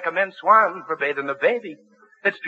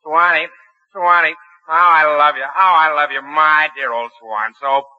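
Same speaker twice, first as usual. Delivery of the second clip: belted Jolson-style crooning ad; Jolson imitation; 4 dB louder, slower than usual. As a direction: sing it like [name] hawking soap. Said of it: "the one where you go".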